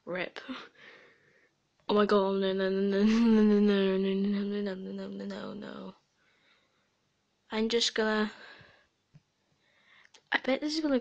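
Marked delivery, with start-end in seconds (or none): 1.9-5.9 rapid string of NOs, loud then fading; panicked no-no-no. Real, not acted. Gamer in trouble in the game, panicked rattling.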